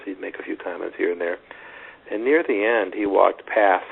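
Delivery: ordinary talk, nothing marked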